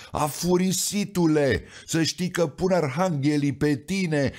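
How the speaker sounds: a saint losing his temper; hammed-up angry threat; mock furious yell. A narrator's reading. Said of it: "strigă el".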